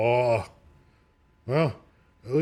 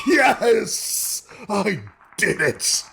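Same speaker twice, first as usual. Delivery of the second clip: triumphant shout; 4 semitones higher, much slower than usual. Real, not acted. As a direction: cheer like you just won. Cheers after landing the plane.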